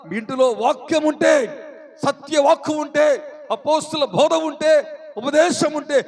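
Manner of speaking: loud, high, driving sermon; fervent pulpit exhortation; full-force preaching. Real, not acted. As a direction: hammer each point home to the congregation.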